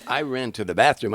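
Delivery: normal talking voice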